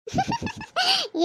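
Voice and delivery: high, soft laughter; a quiet giggle that rises high. Acted. Girl on the phone with her fiancé, soft high laugh.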